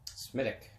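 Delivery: "Smittick" with a hard T and no W sound; Irish way. Shown as a demonstration. Guide on the Guinness tour teaching the name, Irish way. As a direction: say "Smittick", not Smithwick. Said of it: "it's called Smitty".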